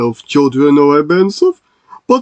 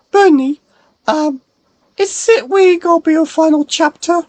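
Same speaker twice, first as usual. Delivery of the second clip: much higher squeaky toy voice; high character voice; 13 semitones higher than usual. Acted. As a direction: voice a little high-pitched friend. High character chats in cinema.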